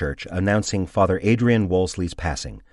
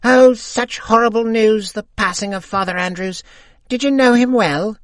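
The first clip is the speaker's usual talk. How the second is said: put-on much higher old lady voice, louder; old woman calling; 8 dB louder than usual. A narrator's reading. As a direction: call out like a friendly old widow. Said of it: "calls to us, as if we are old friends".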